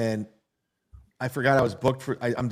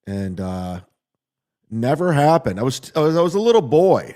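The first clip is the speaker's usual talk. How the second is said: ordinary delivery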